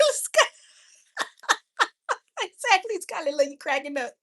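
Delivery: high, helpless laughing speech; cracking up. Real, not acted. Streamer cracks up with Skylar over the dolphins, laughing. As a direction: squeeze the words out through laughter.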